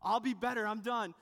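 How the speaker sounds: mock pleading of a scared twelve-year-old; panicked pleading kid. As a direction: beg fast so the driver lets you stay on. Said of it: "you repent and submit really fast and go".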